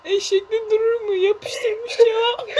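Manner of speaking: high, loud through laughter; squealing with laughter; laughing hard. Real, not acted.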